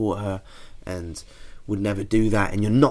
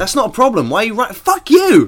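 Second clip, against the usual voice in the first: high, loud exasperated shout; comic furious outburst, building to a yell; mock outrage; 10 semitones higher, 8 dB louder than usual. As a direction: blow up at them, voice leaping higher on the last words. Mock outburst at the letter writer.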